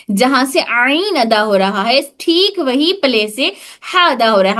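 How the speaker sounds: ordinary talk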